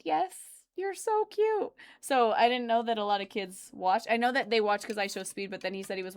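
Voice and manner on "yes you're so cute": much higher doting voice; answering the kid, high and doting; sweet cooing reply. Retold or imitated. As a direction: coo at the child. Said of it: "I was like".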